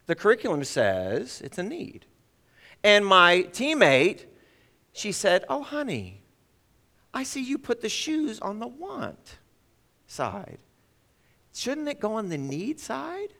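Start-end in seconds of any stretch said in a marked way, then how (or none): 5.5-13.3 higher and softer, a sweetly probing mother; gentle mom voice. Retold or imitated. Mother gently asking her son about shoes, higher and softer. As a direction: coax him like a patient mom.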